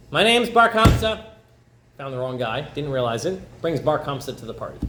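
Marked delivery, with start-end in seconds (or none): 0.1-1.2 high, loud and slow self-introduction; a touch of a loud, drawn-out reply; eager answer. Acted. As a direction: call back your name proudly. Wrong man answers the servant.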